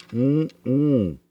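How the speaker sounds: ordinary talk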